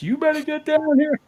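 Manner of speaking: mock shrieky neighbor on the phone; imitating panicked upstairs neighbor. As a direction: mimic a frantic woman phoning for help. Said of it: "The lady upstairs".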